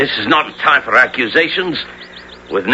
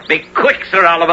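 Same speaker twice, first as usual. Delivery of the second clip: calling out; 9 semitones higher than usual. Acted. Calling after departing Sir Oliver.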